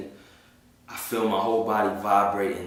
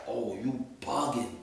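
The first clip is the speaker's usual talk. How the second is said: street attitude; 10 dB quieter than usual. Retold or imitated. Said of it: "I got back into my regular pop".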